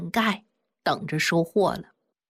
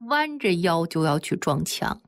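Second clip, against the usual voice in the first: usual speaking voice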